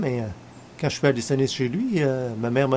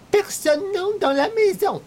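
mimicking her in a much higher, slowed voice; mocking the hostess; 11 semitones higher than usual. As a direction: throw her snub back at her in a high mock falsetto.

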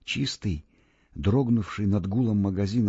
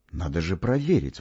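usual speaking voice

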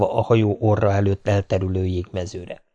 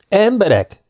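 high, louder, far-carrying call; ringing shout; 11 semitones higher, 3 dB louder than usual. A narrator's reading. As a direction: call out over the whole deck. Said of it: "kiáltotta messze csengő hangon". (a kapitány messze csengő hangon kiált a legénységnek)

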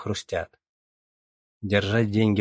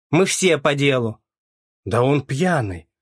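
angry; 5 semitones higher, 5 dB louder, slower than usual. A narrator's reading. Angry queue shouting back.

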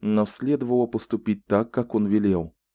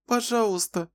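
put-on much higher, pleading child's voice; plaintive little girl; 14 semitones higher than usual. A narrator's reading. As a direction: beg pitifully. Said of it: "жалобно попросила Рэчел". (жалобная просьба девочки)

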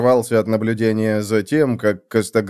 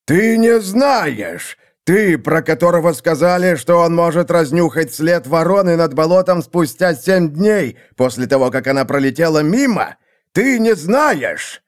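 imperious squeak; 7 semitones higher than usual. A narrator's reading. Seer berates the clueless assassin, imperious squeak. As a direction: berate him, voice climbing to a squeak. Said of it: "повысился до властного писка".

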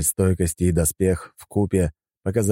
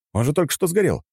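higher-pitched protest; crying out; 10 semitones higher than usual. A narrator's reading. Hero protests the enemy already burned, crying out. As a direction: blurt it out in disbelief. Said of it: "крикнул я".

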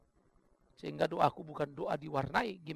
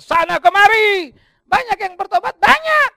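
loud, high, slow climbing cry up to banyak; rising preaching cry; 19 dB louder, slower than usual. Acted. Pastor crying out to the congregation about the apostles, loud and high. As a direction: build it loud and high to the top.

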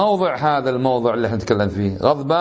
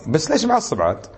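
provoking tease; 3 semitones higher than usual. Retold or imitated. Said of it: "بعض الناس تكلم كلام عادي".

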